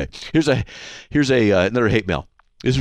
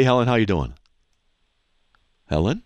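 ordinary delivery

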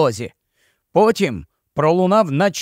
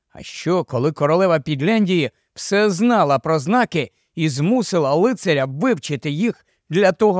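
plain reading voice

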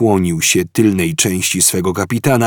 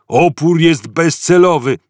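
guard roaring; 9 semitones higher, 5 dB louder than usual. A narrator's reading. Guard to prisoners, loud roaring bark. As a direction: stress on Opór, loud bark.

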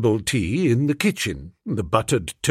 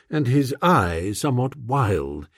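ordinary delivery, nothing marked

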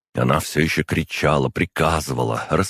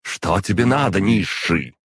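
higher-pitched indignant demand; angry cry; 6 semitones higher, 3 dB louder than usual. A narrator's reading. Demon yanked away mid-battle challenges her summoner, crying out. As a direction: cry out at an underling in outrage. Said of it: "крикнула".

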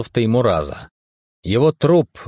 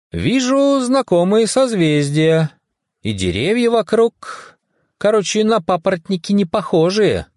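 higher-pitched, lightly voiced character taking in the view; mildes Staunen; 10 semitones higher than usual. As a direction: report the sighting with a touch of wonder, pitch lifted. Figur bestaunt den Sternenhimmel.

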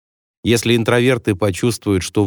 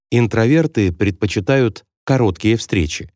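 ordinary talk

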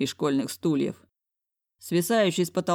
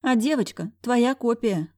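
higher slow knowing remark; higher sly housekeeper; teasing housekeeper; 7 semitones higher than usual. A narrator's reading. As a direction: needle him.

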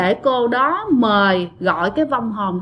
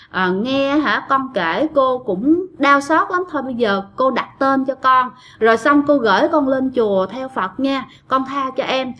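ordinary delivery

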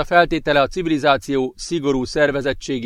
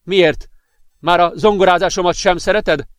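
louder, higher angry retort; angry shout; 5 semitones higher, 5 dB louder than usual. A narrator's reading. Boyfriend yelling at girlfriend over his piano, angry shout. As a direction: snap back, stung and furious. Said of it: "kiáltotta dühösen".

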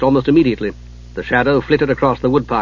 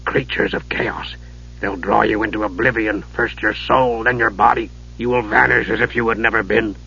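low dread tones; 3 semitones lower, 4 dB quieter than usual. A narrator's reading. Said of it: "low, dread tones".

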